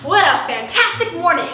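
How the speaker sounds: ordinary talk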